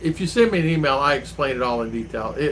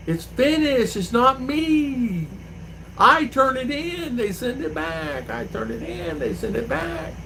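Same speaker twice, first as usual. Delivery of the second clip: whiny high clerk voice; 10 semitones higher, slower than usual. Retold or imitated.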